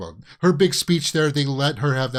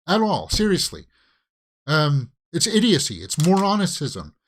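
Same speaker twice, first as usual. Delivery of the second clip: higher, louder, fed-up disbelief; exasperated; 4 semitones higher, 3 dB louder than usual. Real, not acted. Fan venting at the screen over a bad vote, exasperated. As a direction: hammer home the frustration. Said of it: "I'm not gonna get my feathers ruffled here".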